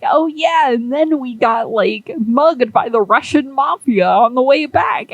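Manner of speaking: a lightly voiced Zug, breezy and unbothered; casual Zug voice. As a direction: toss it off like it's nothing. Zug casually recalls being mugged.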